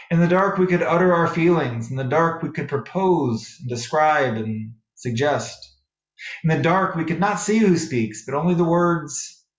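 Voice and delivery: ordinary talk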